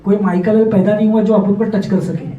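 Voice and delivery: rattled off very fast in Mumbai tapori Hindi; tough-guy Mumbai swagger, fast; तेज़ बंबइया टपोरी अंदाज़; Mumbai street slang. Retold or imitated. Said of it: "we used to speak like this".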